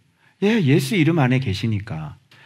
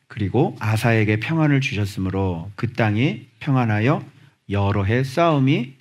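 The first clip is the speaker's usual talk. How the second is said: normal talking voice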